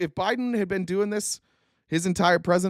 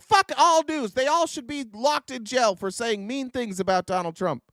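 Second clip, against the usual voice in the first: mocking angry fan; 7 semitones higher than usual. Comic impersonating furious Trump fans to the audience, high mock rant. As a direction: rant like an outraged fan, pitched much higher. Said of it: "and then be like".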